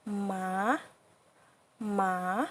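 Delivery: scoop "ma, ma" down and back up; third tone. Shown as a demonstration. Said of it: "or horse".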